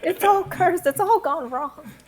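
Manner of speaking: high-pitched panicked wail. Acted. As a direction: keep up the panicked act, voice way up high.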